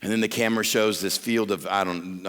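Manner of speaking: ordinary talk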